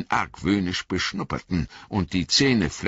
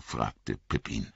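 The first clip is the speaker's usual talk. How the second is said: ordinary delivery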